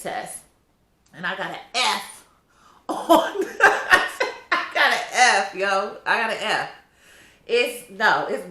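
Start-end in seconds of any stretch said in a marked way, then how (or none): 1.7-6.7 high laugh breaking into the words; laughing in disbelief. Real, not acted. Vlogger laughing at her own failed statistics test, disbelieving. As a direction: laugh it out, can't believe it.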